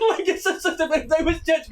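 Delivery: high, loud laughing through the words; cackling while talking; laughing at own bluntness to chat, high and loud. Real, not acted.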